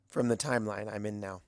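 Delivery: usual speaking voice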